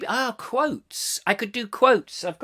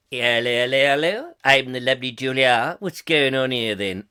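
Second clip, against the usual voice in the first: lower, mock village bobby patrol voice; hammed-up policeman; 4 semitones lower than usual. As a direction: play a plodding old copper asking questions. Playing bobby-style Julia character.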